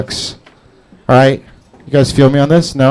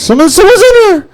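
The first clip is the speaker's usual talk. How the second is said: high squeaky falsetto shriek; panicked cartoonish yelling, pitched way up; mock scream; 13 semitones higher, 3 dB louder than usual. Man on the toilet warning the knockers, squeaky mock scream. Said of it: "screaming off the top of my lungs".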